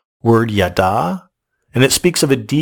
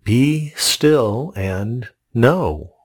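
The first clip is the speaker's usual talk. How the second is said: slow quoted scripture; much slower than usual. Acted. Quoting God's command slowly.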